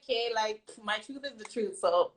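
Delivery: soft, offhand, shrugging it off; casual shrug. Retold or imitated. To the critics, a soft, offhand shrug of a quote. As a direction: say it like it's obvious and no big deal. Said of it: "you know when you just like".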